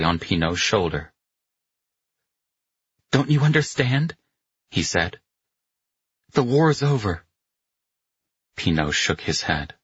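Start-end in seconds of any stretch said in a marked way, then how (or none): 3.1-4.2 higher-pitched, pressing question; earnest urging. A narrator's reading. Carletto with hand on Pino's shoulder, earnest urging. 6.3-7.2 higher-pitched, emphatic news; earnest urging. A narrator's reading. Carletto telling stunned Pino of the peace, earnest urging.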